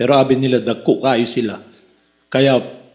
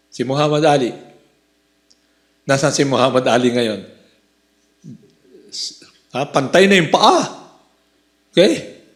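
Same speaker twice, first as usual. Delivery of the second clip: normal talking voice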